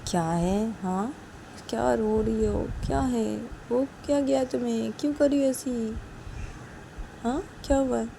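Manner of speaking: concerned Sanju. Acted. Sanju asking crying Uma what is wrong, concerned. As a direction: gently insist with anxious questions.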